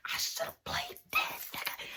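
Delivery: slow, very high whispered aside; half-joking whispered regret. Retold or imitated. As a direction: breathe it out like a secret afterthought. Wry aside after thinking it over.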